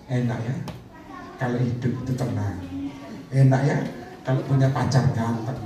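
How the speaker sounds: low, musing wishfulness; idle daydreaming. Retold or imitated. Preacher mimics dreamers who never act, low and musing. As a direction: muse lazily in a low voice. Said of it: "kalau tidur ini ngelamun".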